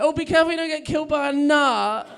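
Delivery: high, loud mock warning; mocking concern. Girlfriend's family warns him about nuts, mockingly. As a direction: tease with exaggerated high-pitched worry.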